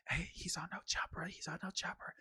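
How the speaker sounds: hushed, pointing him out to his family; whispering. Retold or imitated. Kid at Costco whispering to his family, hushed. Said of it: "I just heard him whispering".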